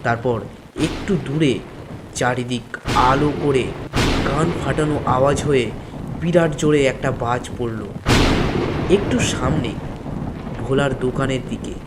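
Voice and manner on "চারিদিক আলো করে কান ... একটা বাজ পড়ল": ordinary delivery, nothing marked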